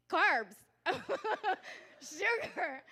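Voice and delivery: loud, high, breaking into laughter; laughing exclamation. Real, not acted. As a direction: blurt it out between laughs. Laughing at her childhood photos.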